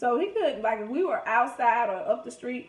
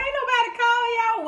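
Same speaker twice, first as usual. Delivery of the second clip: cousins scoffing; 12 semitones higher, 8 dB louder than usual. Retold or imitated. Cousins retorting.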